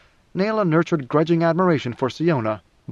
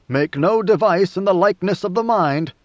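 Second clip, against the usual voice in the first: higher, emphatic recitation; solemn commandment; 4 semitones higher, 4 dB louder than usual. A narrator's reading. Quoting the forbidden rule of the Great Convention, emphatic. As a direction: declaim it as a sacred rule.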